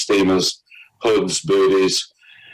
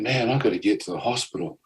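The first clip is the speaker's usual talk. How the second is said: weak inner thought; 7 dB quieter than usual. Retold or imitated.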